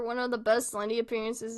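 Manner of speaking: ordinary talk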